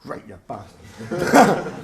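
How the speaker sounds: slow, gruff mock fighter taunt; hammed-up slow fighter voice; fighter's growl. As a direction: drag it out like a riled-up fighter. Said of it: "and he goes".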